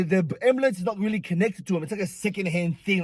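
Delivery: usual speaking voice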